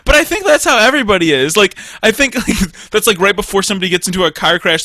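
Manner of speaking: ordinary delivery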